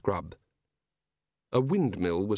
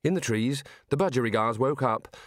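usual speaking voice

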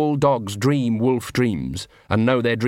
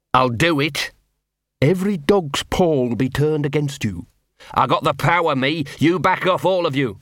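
tag; 3 semitones higher than usual. A narrator's reading. Dog threatens pack with power.

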